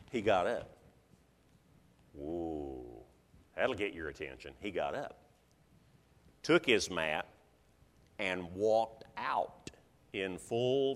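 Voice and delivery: low, soft, suspenseful narration; hushed awe. A narrator's reading. Preacher telling of the paralytic walking out, hushed. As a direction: drop right down and let it unfold in a hush.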